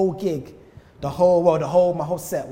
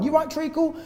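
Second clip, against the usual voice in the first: higher, breezy Cockney "You right, Treacle?"; Cockney greeting; 11 semitones higher than usual. Retold or imitated. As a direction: toss it off in a Cockney accent. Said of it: "in a Cockney accent".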